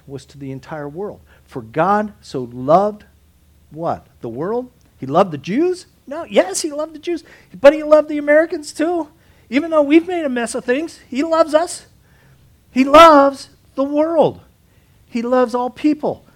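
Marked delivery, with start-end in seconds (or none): 5.0-16.2 higher and louder, preaching at full force; fiery preaching. Acted. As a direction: preach God's love to the whole room. Preacher rises to a climax.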